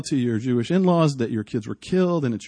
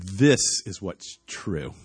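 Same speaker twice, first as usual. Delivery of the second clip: voice of God; 6 semitones lower, 5 dB quieter than usual. Retold or imitated. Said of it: "And God was saying".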